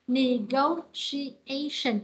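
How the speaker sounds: "Negotiation" very slow, syllables separated; double stress. Shown as a demonstration. Teacher shows the class two stresses, slow and segmented. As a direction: punch "go" and "a" in "Negotiation", very slowly. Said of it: "có hai dấu nhấn này".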